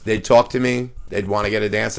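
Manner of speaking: ordinary delivery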